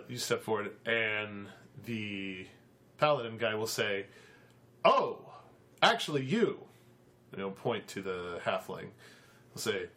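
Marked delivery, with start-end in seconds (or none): 4.9-6.6 high, louder "oh, actually you"; pointed surprise. Acted. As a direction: catch him out, raising the voice. Paladin singles out the halfling.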